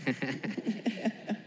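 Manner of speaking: a high, light laugh; laughing along at the pastor's joke. Real, not acted. (risa aguda y breve)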